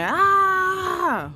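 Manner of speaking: loud high scream of rage. Retold or imitated. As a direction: let out a piercing furious scream. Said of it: "So I was screaming".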